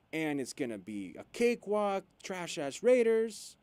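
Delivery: mocking Saints fans. Podcaster mocking boastful Saints fans, hyped and loud. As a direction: ham up a cocky Saints fan. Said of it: "Saints fans were saying".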